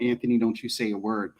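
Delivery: ordinary talk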